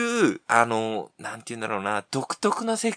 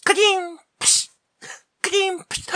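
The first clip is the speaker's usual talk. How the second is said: batting sound effects. Acted. Bat hitting soup.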